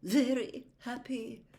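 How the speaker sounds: a touch sniffy and slow. A narrator's reading. Trelawney disdaining the intruder's glee, sniffy.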